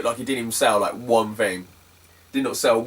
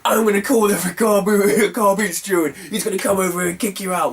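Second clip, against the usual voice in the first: high imitation of an irate seller; mock angry threat; 6 semitones higher than usual. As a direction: threaten like an outraged stallholder, hammed-up. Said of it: "he was getting angry".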